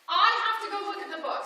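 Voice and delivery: high, fast, urgent; can't resist, rush it out; pulled toward the book, urgent; compelled urgency. Retold or imitated. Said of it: "Something compels me".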